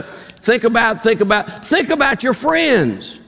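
very high, drawn-out mock plea; mock-desperate groping. Counselor hunting for reasons, hammed-up desperate pleading. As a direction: restart haltingly, then plead high. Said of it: "he's really desperate".

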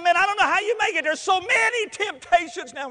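high, loud, overblown warning; alarmist preacher. Retold or imitated. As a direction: lament it with mock-preacher alarm. Preachers alarm teens about temptations.

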